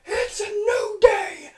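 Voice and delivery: shouted "New Day"; excited cheer. Real, not acted. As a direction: cheer it like a crowd. Cheering entrance announcement.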